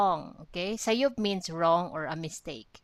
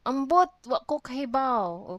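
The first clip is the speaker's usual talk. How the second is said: exasperated shrug; 6 semitones higher, 6 dB louder than usual. Acted. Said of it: "Dodong said".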